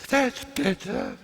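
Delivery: put-on high, breathy elderly English lady; breathy old lady voice. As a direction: imitate a genteel old lady breathing in as she speaks. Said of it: "that sort of strange voice".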